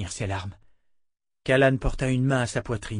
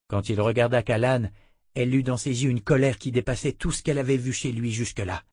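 ordinary delivery

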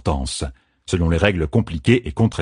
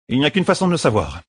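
quick, high dry quip; dry bodyguard; 6 semitones higher, faster than usual. Acted. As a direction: toss off a dry answer. Birgit dry reply. (réplique sèche et rapide)

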